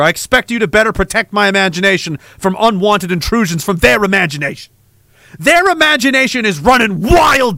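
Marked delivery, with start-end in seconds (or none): 5.4-7.6 scolding shout. Acted. Scolding imaginary guard.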